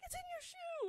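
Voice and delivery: shriek. Retold or imitated. Friend shrieks spider in shoe.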